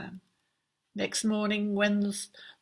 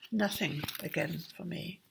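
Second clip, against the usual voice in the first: grim; 3 semitones lower, 8 dB quieter than usual. A narrator's reading. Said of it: "said grimly".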